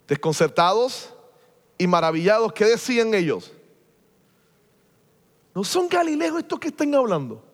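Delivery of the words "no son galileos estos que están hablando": amazed crowd. Retold or imitated. Amazed crowd voiced from Acts.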